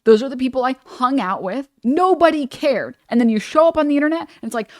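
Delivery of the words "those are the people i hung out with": normal talking voice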